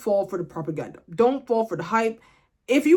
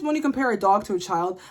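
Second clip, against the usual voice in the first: ordinary delivery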